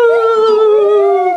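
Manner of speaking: very high, loud spooky ghost hoot; haunt them with a big ghostly woo. Acted. Ghost haunting the sleeping girls, high spooky woo.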